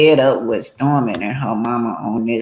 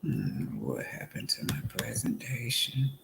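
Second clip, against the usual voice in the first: whisper to herself; 3 semitones lower, 19 dB quieter than usual. Real, not acted. Muttering to herself, stream glitch.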